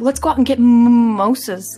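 loud. Retold or imitated. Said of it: "I'll be like".

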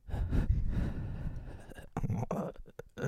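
voiceless, drawn-out breath laugh; breathy laugh. Shown as a demonstration. Buddy dares him to do the breath thing, voiceless Butthead-like laugh. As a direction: laugh on the breath alone, stretched out. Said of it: "Do that breath thing".

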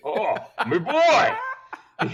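high, loud Boston-accented imitation of dad; imitating his late father's Boston accent; dad's Boston accent. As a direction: do your dad's Ted Kennedy Boston voice, laughing. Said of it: "I might need an impression right now".

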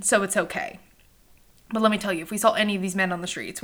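ordinary talk

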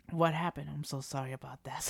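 ordinary delivery

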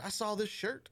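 high-pitched Southern belle drawl. Retold or imitated. Retelling her Vegas trip, in an imitated Southern belle voice. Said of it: "imagine the voice".

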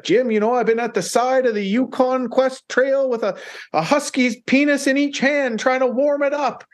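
high-pitched earnest musher voice warning Jim; squeaky, wide-eyed warning about the dogs; imitating the musher. Musher warns Jim about frozen dogs, high mock voice. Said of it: "And he's like".

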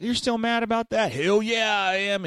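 mocking voices. Retold confrontation, voiced quotes.

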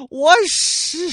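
high, loud startled blurt; shocked outburst. Acted. Soldiers finally see what surrounds them, loud startled blurt. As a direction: burst out, then cut off.

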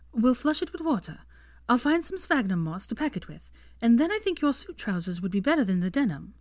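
lightly voiced Hermione, anxious and bossy; brisk young witch. A narrator's reading. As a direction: Hermione acting the healer, briskly. Narrator voices Hermione taking charge.

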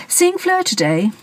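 light, high jaunty question; breezy, bouncing up high. A narrator's reading. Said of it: "he jauntily said".

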